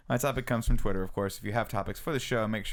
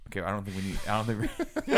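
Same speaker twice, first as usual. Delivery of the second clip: ordinary delivery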